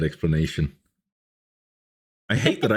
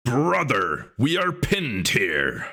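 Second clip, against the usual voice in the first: slow dramatic voice; 4 semitones higher, much slower than usual. Pretend. Dramatic mock line to chat.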